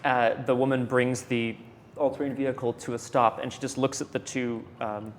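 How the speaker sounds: ordinary talk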